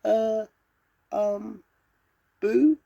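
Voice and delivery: very high, squeaky little lion voice; timid squeak. A narrator's reading. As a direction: squeak out a tiny, hesitant attempt at a scare. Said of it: "he squeaked".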